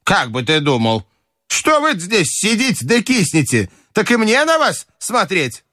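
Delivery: high, indignant grandmother; бабушка отчитывает. Acted. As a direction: scold them in a high old-lady voice. Grandmother scolds her company. (высокий возмущённый голос бабушки)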